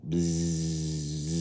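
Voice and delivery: long, low, sustained buzz; bee buzzing. A narrator's reading. Bee flying near the beehive, long buzz. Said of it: "And the bee".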